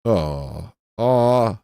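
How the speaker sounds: usual speaking voice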